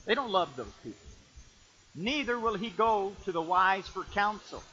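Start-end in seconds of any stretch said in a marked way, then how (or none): 1.9-4.6 scripture reading. Shown as a demonstration. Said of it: "scripture verse".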